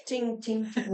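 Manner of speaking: high ringing ting of a bullet; ping it like a distant ricochet; voiced metallic ricochet sound; imitated bullet pings. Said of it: "hear the bullet in the distance".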